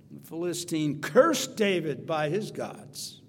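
normal talking voice

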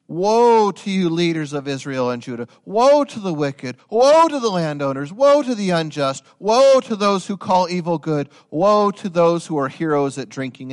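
loud, high, stressed woe on each line; fiery preacher declaring judgment; pronouncing woes. Acted. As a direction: thunder each woe like a prophet. Prophet pronouncing woes on people.

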